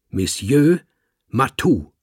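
höher, lauter, französisch betont Monsieur Matou; French. Acted. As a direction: stolzer Kater besteht auf Monsieur Matou. Kater betont seinen Namen. (Kater korrigiert den Dichter, französisch und stolz)

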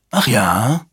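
short, disdainful query; dismissive. A narrator's reading. Commander answering the warning about the machine, dismissive. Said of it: "erwiderte Strathmore abschätzig".

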